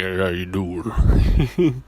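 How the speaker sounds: slow, low, breathy-free deep hello; mock of his girl's low voice; imitating girlfriend's low voice. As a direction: drag it out low and lazy. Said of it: "My girl has a pretty low voice".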